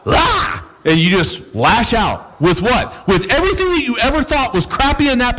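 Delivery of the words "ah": high shrieking yell of someone snapping; demonic outburst. Retold or imitated. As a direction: explode into a sudden scream. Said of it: "you're like".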